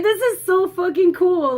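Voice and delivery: high, loud fan enthusiasm; re-enacted excitement. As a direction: gush it loudly, reliving the thrill. Quotes own excited reaction to movie.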